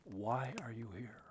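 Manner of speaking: soft, faint whispered question. Retold or imitated. A mysterious inner voice asking the seeker by the fire, in a faint whisper. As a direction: barely breathe it, like a distant voice. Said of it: "I heard a voice that was so faint".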